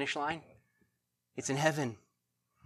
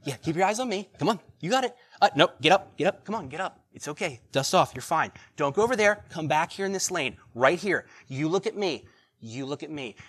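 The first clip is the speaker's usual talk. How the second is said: encouraging coach; 3 semitones higher, 7 dB louder than usual. Retold or imitated. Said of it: "It's almost like Jesus running backwards".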